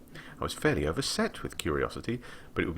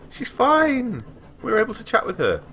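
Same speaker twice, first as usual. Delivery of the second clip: higher, louder happy words; bubbling relief; relieved excitement; 6 dB louder than usual. Real, not acted. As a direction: glad that Rosa is safe, excited. Relief at friend.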